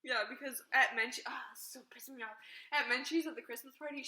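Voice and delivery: ordinary talk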